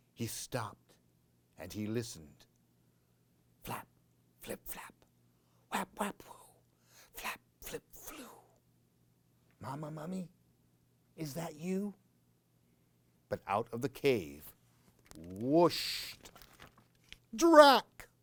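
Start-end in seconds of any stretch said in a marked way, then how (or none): 3.6-8.3 breathy whispered flapping; spooky hushed wing noises; whispered sound effects. A narrator's reading. Bat wings sound effect. 9.6-11.9 soft, timid little voice; hushed nervous call for mama; scared soft call. Acted. Baby mummy calls in cave. 17.3-18.1 loud, high jump on the name; spring the name on them; startling reveal of the vampire bat; sudden reveal. A narrator's reading. The bat appears.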